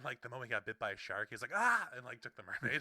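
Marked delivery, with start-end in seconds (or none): 1.5-1.9 short, high, loud mock scream; imitated shocked yelp. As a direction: yelp as if bitten by a shark. Child bitten by shark.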